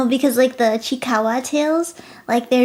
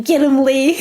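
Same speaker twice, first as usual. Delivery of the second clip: laughing cheer; 4 semitones higher, 7 dB louder than usual. Real, not acted.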